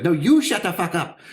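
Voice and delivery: high, loud retort; imitated cashier yelling back; fed-up shout back. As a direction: fire it straight back at them. Woman snaps back at hecklers.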